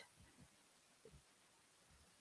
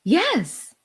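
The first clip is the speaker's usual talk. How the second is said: more excited; 9 semitones higher than usual. Shown as a demonstration. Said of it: "But more excitedly".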